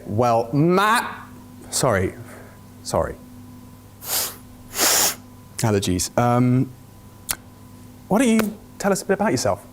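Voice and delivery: normal talking voice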